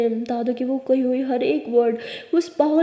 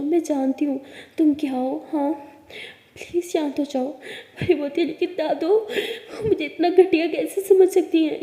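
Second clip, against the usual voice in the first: plain reading voice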